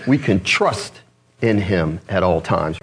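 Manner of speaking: "We can trust in him at all times" word by word, with pauses; weight every word of "trust in him at all times"; each word emphasized. Shown as a demonstration. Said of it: "You just emphasize each word there".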